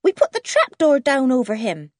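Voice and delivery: put-on high child's voice owning up; high-pitched little voice; young Jan. A narrator's reading. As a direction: pipe it up matter-of-factly.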